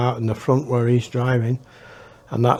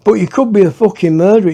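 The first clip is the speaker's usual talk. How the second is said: fast, higher, louder accusation; suspicious retort; 6 semitones higher, 5 dB louder, faster than usual. Retold or imitated. Howard challenges the butcher, fast and loud. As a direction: fire back the suspicion quickly. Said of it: "and then Howard says".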